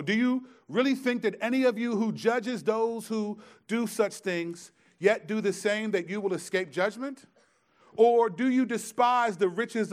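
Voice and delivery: loud, high scripture reading in full preaching fervor; preaching at full force. Real, not acted. Preacher reading Romans to the church, booming and high. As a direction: thunder the verses out to the congregation. Reads Romans 2 aloud loudly.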